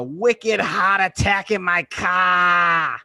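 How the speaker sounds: put-on wicked heart attack in a Boston accent, shouted slow at the end; Boston accent, blown up to a shout. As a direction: ham up a thick Massachusetts accent. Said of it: "that awful accent".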